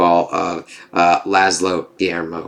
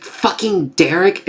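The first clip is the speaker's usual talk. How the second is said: higher, slow, incredulous imitation; mock disbelief; 10 semitones higher, slower than usual. Nadja hearing Derek did it, slow mock outrage. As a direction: drag it out in shocked disbelief.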